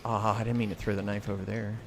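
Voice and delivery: ordinary delivery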